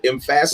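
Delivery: "emphasis" said em-FASS-is; changed word stress. Shown as a demonstration. Admitting the trick to co-host, "emphasis" said em-FASS-is. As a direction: move the stress in "emphasis" to the second syllable, FASS. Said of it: "I changed the emphasis".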